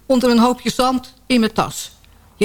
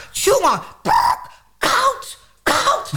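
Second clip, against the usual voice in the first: cold shock; 12 semitones higher, much slower than usual. Acted. Wading into icy sea.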